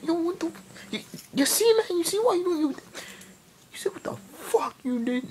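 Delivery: high-pitched sputtering mock fury; Philip. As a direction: stammer it out, squealing with outrage. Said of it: "And Philip got mad".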